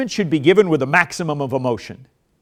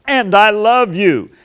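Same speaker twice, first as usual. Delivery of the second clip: louder slow I love you; passionate love; 6 semitones higher, 8 dB louder, slower than usual. Shown as a demonstration. Spouse ending correction with passionate love. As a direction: end on a warm push.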